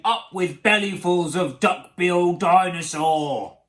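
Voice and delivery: loud chanted war cry; bellowed with hungry relish; shouting. A narrator's reading. Tyrannosaurs cross the river to hunt, loud shout. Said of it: "And they shouted".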